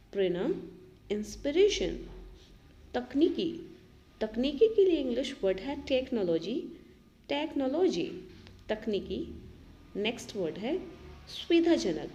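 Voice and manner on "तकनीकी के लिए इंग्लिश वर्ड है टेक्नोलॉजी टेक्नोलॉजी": ordinary delivery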